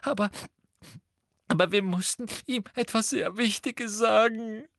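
put-on high, whining girl's voice; wailing. A narrator's reading. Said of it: "jammerte Hermine".

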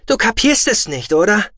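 loud, high, exasperated; can't believe he doesn't get it. Acted. Brother exasperated.

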